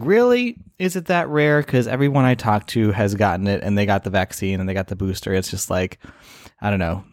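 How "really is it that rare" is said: retold disbelief. Retold sceptical reply to Pfizer.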